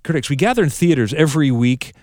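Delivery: usual speaking voice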